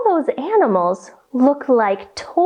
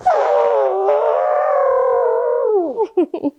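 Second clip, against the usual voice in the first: high trumpeting elephant call; 11 semitones higher than usual. Shown as a demonstration. Teacher showing kids her elephant sound, high trumpet. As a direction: lift the trunk and trumpet high. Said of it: "make your best elephant sound".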